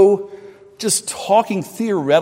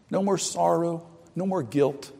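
normal talking voice

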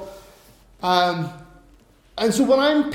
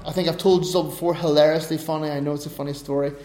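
ordinary talk

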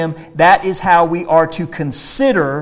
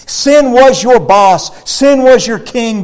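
higher and louder, slow, leaning on was; forceful preaching; 6 semitones higher, 6 dB louder, slower than usual. Acted. Preacher warning the congregation about sin, forceful slow declaration. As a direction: hammer home that sin's reign is over. Preacher denouncing sin's past rule.

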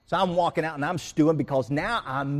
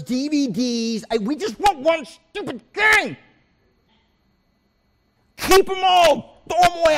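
exasperated rant; 10 semitones higher, 8 dB louder than usual. Retold or imitated. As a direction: vent the whole wasted trip in a yelling rant. Ranting about the wasted trip.

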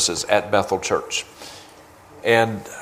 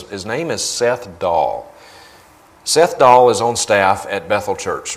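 ordinary talk, nothing marked

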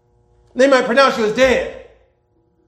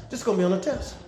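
softer, hammering the point home; quiet repeat; 8 dB quieter, faster than usual. Shown as a demonstration. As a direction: drop the voice and repeat it. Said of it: "It's worth repeating".

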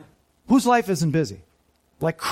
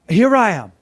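loud, ringing volunteer's answer; loud, willing reply to God's call; eagerly stepping up; 3 semitones higher, 7 dB louder than usual. Acted. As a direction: step up and announce yourself boldly. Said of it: "is that us stepping up?".

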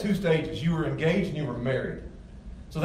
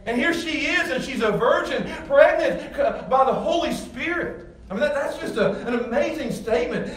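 full-force preaching; 7 semitones higher than usual. Acted. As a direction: preach it out, marvelling and climbing.

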